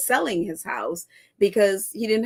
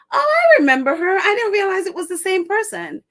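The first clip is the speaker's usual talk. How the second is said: surprised recognition; 9 semitones higher, 4 dB louder than usual. Real, not acted. Recognizing the girl from Global Citizens.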